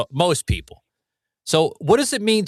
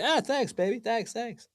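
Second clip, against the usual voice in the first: put-on higher, much softer young-guy voice; a soft, higher impression of a younger man; 8 semitones higher, 9 dB quieter than usual. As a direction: lift it and breathe it out like a young lover. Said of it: "if you were younger, you would say".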